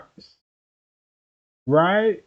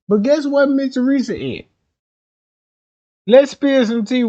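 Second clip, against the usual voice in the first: high, loud teasing hype; hyped up; 8 semitones higher, 5 dB louder than usual. Real, not acted. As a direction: tease the gossip, all hyped.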